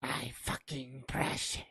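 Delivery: soft, possessive film-villain croak; creature impression. Joker quoting the precious line with a swear, hushed creature voice. Gollum's catchphrase, acted with a swear.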